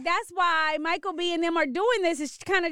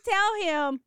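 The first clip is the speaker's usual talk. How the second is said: ordinary talk, nothing marked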